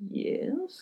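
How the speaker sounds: high, soft questioning yes; a hint of confused reply; puzzled yes. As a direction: answer unsure who he is. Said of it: "and I'm like".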